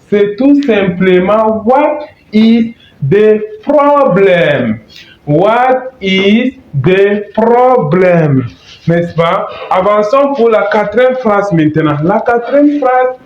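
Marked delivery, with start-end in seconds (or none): 0.1-4.8 slow model phrase. Shown as a demonstration. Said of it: "teacher models slowly". 5.3-8.4 very slow, each word drawn out; slow model phrase. Shown as a demonstration. Teacher repeats the phrase for students, very slowly. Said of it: "teacher models slowly".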